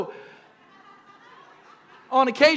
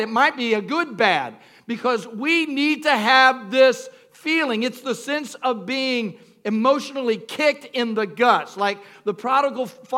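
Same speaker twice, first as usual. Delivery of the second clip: normal talking voice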